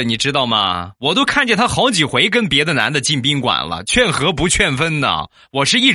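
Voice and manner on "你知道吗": usual speaking voice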